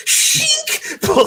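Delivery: sudden squealed name, way up high. Acted. Teasing a friend's villain role with a high squeal. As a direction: squeal the name in mock awe.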